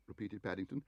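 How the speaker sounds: ordinary delivery, nothing marked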